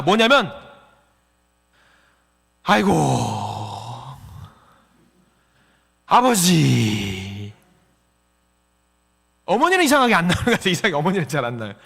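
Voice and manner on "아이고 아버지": long, slow drawn-out lament, falling low; habitual sighing groan. Acted. Preacher showing his own habitual saying, sighing groan. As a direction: stretch it into a weary groan. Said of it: "습관적으로 나오는 말이 있습니다".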